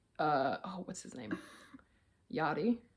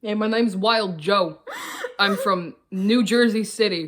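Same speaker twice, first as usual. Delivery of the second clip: a hammed-up New Jersey tough guy, giggling; Jersey accent; 3 dB louder than usual. Asked by her co-host, doing a mock Jersey accent. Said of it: "You do a Jersey accent".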